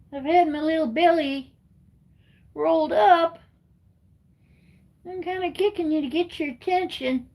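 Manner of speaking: put-on high, whiny baby cat voice; cat's voice. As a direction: voice the cat begging for belly rubs. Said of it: "He's kinda kicking me and saying".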